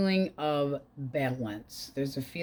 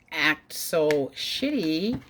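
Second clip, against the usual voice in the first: ordinary talk, nothing marked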